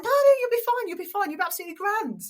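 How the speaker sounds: high, quick brushing-off of worries; imitated reassurance. Announcer to nervous stand-in before the game, reassuring. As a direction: wave the nerves away, breezily insistent. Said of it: "him reassuring me".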